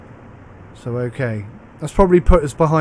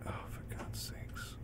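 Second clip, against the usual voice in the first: frustrated whisper; 24 dB quieter, slower than usual. Real, not acted.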